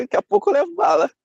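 hammed-up fear, fast and high; mock panicked thought. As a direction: blurt it out like you're sure you'll get shot. Retold fear approaching armed soldiers.

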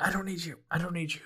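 soft, sulky self-talk; quiet, wounded muttering; muttered rebuff. Acted. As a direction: drop it right down, dismissing them. Rebuffed mole mutters to himself.

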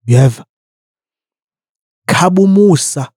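ordinary talk

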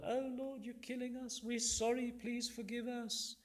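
high mock pleading; fake repentance. Israelites in the desert flatter God, fake repentance. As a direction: whine a flattering, insincere plea. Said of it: "Fake repentance, flattering God".

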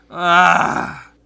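loud drawn-out "ah" of exasperation; loud exasperated groan; frustrated groan. Real, not acted. Fan groaning over a terrible pitching matchup, frustrated.

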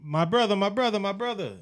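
high, pleading "my brother" call; put-on eager stranger reeling him in; imitating the stranger. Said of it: "he's like".

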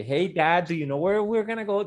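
imitating a little girl who only speaks English; mock gringa niece. Uncle imitates his niece talking to her dad like a little gringa. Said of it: "ya es una gringuita de que".

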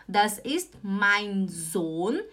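slow, separated words; slow model sentence. Shown as a demonstration. Teacher modelling "this is my son" in German, slowly. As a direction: stretch each word for learners.

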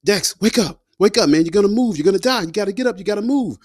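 high urgent yell to wake up; desperate call to his unconscious self; yelling to himself. Retold or imitated. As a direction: shout at your own body to wake up. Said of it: "I just remember yelling to myself".

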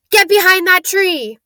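high, loud cry of alarm; urgent shouted warning. A narrator's reading. Hagrid warns the children of danger, urgent shout. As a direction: shout it as a sudden order to take cover.

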